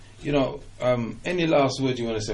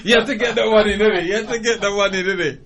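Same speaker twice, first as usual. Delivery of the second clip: laughing, higher, teasing the guest; 8 semitones higher than usual. Real, not acted. Host laughs that the guest slipped a line in, laughing. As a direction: tease him through giggles.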